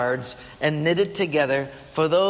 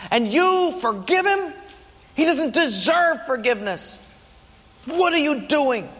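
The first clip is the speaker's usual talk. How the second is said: indignant protest; 9 semitones higher than usual. Retold or imitated. Elder son confronts his father, a high indignant outburst. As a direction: throw the question at him in disbelief. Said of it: "came and said".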